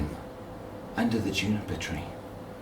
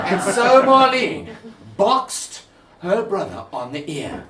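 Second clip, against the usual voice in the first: louder and laughing through it; louder retelling of the box on the ear; 11 semitones higher, 7 dB louder than usual. Shown as a demonstration. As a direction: punch it out louder, giggling. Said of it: "It was a little bit louder than that".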